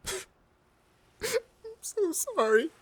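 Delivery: very high, slow, crying wail; sobbing apology. Acted. Captain weeping his apology to Lily. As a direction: let the sorry break into sobs.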